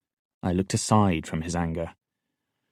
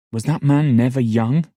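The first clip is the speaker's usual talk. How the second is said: indignant outburst; 6 semitones higher, 6 dB louder than usual. Acted. Fitz indignant at the king.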